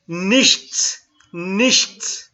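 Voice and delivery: emphatic Nichts, ts sharp; model pronunciation. Shown as a demonstration. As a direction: repeat Nichts for the class to copy. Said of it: "You need to read it like this".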